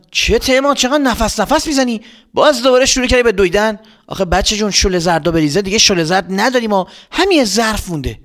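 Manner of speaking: high fussy mother voice. Acted. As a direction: nag the breathless son. Voicing mother.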